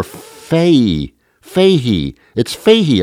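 "fahey" said FAY-hee, no g; silent G. Shown as a demonstration. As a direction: sound out "fahey" with an airy h for the g. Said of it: "I don't even pronounce the G".

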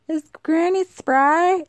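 put-on high, slow little-old-lady voice; granny voice. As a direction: ask it like a sweet granny. Teasing self in granny voice.

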